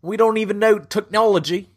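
high, pleading fake Cockney; high-pitched makeshift Cockney; attempted Cockney. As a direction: beg in a badly faked Cockney accent. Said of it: "You gotta do Cockney".

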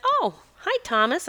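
high, bright surprised hello; delighted greeting. Retold or imitated. Healer greeting the revived bird, delighted and high. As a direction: greet him like a long-lost friend.

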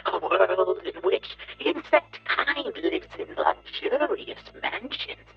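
booming megalomaniac bug ranting; evil villain rant. Acted. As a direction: roar it at the puny humans. Villain gloats over humanity.

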